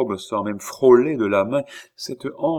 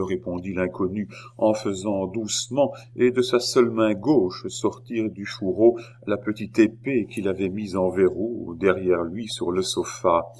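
ordinary delivery, nothing marked

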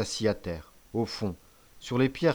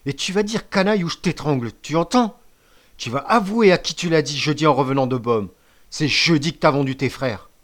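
loud, higher angry interrogation; threatening; 4 semitones higher, 7 dB louder than usual. A narrator's reading. Chief threatening to strangle the traitor, angry demand. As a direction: demand a confession with menace.

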